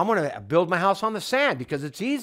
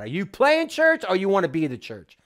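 normal talking voice

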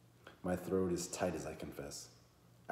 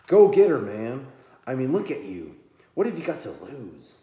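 hyped-up cheer; 7 semitones higher, 16 dB louder than usual. Acted. Matthew smacks him, cheering him on.

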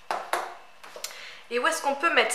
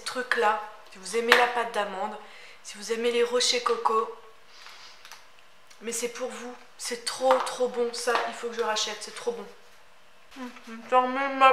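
muffled words around food; speaking while chewing; 2 semitones lower, 5 dB quieter than usual. Real, not acted. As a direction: mouth partly full of cake. Seen 1: Bites cake at -9; cheeks bulge while talking; still chewing afterwards.

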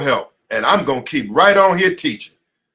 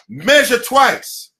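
higher and stretched, spaced out; slow emphatic motto; 5 semitones higher, much slower than usual. Acted. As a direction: lay out the motto slowly for them to remember.

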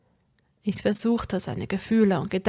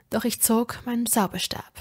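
ordinary delivery, nothing marked

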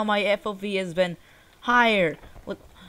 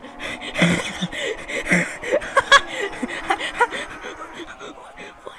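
long, high, wheezy laughing fit; helpless laughter; 12 semitones higher than usual. Real, not acted. Gamer cracking up at the glitch, helpless laughter. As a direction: crack up, barely getting breath.

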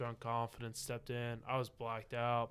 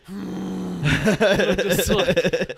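slow, high, loud, dissolving into laughs; helpless laughter; 7 semitones higher, 16 dB louder, much slower than usual. Real, not acted. Telling friends the bar story, breaking into laughter. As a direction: lose it mid-sentence.

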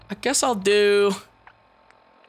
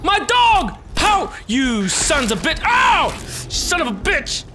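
outraged yelling; 8 semitones higher, slower than usual. Real, not acted. Player cursing mole rats hurting the dog, outraged. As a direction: yell in outrage at the attackers.

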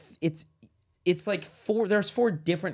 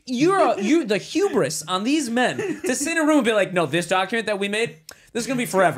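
mock pompous founders; 7 semitones higher, 4 dB louder than usual. Mocking founders' hubris.

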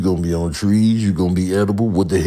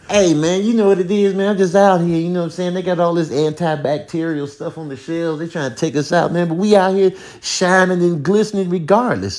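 cocky bacterium, pitched way up; a boastful little germ bragging at the poker table; high bacteria voice; 9 semitones higher than usual. Acted. Bacteria character at card game.

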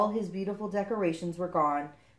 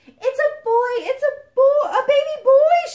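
very high, loud squeal of delight; overjoyed new mother, crying out; joyful cry; 14 dB louder than usual. Acted. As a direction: shriek the happy news. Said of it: "she cried".

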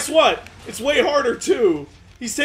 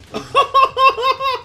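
loud high-pitched laughter; real helpless cackling; 5 dB louder than usual. Real, not acted. Cracking up during gameplay.

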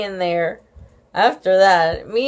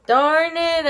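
higher, disappointed groan; childish disappointment; 8 semitones higher than usual. A narrator's reading. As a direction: let the letdown show. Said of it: "I said, very disappointed".

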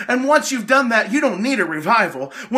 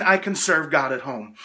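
mock excuse; 7 semitones lower than usual. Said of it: "Are you just saying".

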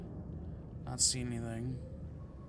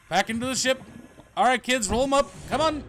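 singsong parent voice; 9 semitones higher, 4 dB louder than usual. Pretend. Herding creatures into ship.

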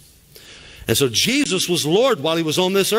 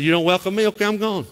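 1 casual dismissal; 5 semitones higher, faster than usual. Retold or imitated. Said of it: "So he said".

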